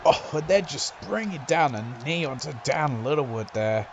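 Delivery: usual speaking voice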